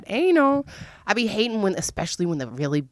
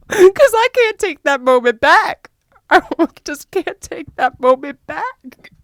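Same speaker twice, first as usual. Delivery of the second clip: mock-sad lament; 12 semitones higher, 6 dB louder, slower than usual. Real, not acted. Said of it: "And I'm sad about it".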